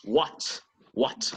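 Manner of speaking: "what" with a strongly released T; "what" ending in a crisp, audible T; British final T. Shown as a demonstration. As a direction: say "what" British style, making the T sound a lot. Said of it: "making the T sound a lot".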